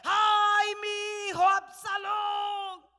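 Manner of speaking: loud, slow anguished wail, drawn out; crying out. Retold or imitated. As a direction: cry out in grief for a lost son. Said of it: "David comienza a llorar y sale". (lamento a gritos, lento y desgarrado)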